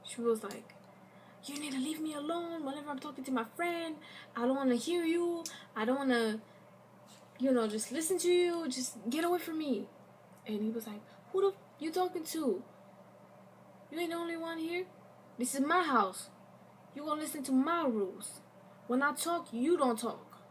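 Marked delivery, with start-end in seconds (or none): 1.4-9.9 high-pitched, heated defensive retort; a retold defensive outburst, pitched well up. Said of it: "got really defensive". 11.3-20.4 pitched high, imitating a domineering rant; mock-angry man barking house rules; angry boyfriend. Abusive boyfriend yelling at girlfriend.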